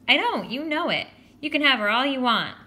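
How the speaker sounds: high, loud insistence; defensive protest. Acted. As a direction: protest indignantly. Boys quarrel, Joey protests.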